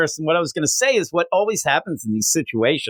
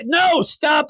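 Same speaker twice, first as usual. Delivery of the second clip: high, raised-voice interruption; mock exasperated parent; 13 semitones higher than usual. Parent stopping son mid-spelling, high mock exasperation. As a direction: cut the kid off, pitched up.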